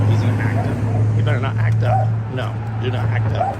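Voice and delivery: scolding the dog, loud and firm; loud warning to dog. Real, not acted. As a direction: warn the dog off, loudly.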